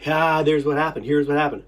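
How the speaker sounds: usual speaking voice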